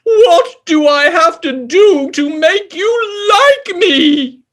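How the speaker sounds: a hurt king begging to be liked; King Pig pleading. Acted.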